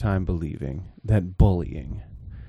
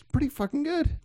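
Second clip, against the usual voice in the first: mock squeak.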